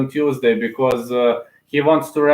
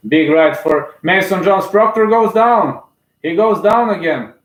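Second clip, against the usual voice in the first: higher and louder, slowing on the knockdown; thrilled commentator yelling the knockdown; excited knockdown call; 6 semitones higher, 4 dB louder than usual. Real, not acted. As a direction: shout it as he drops. Calls the knockdown live.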